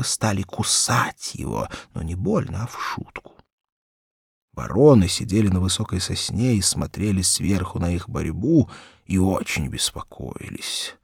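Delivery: ordinary delivery, nothing marked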